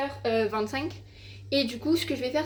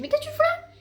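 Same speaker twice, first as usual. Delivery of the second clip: mother's shocked voice; 4 dB louder than usual. Retold or imitated. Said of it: "elle me dit".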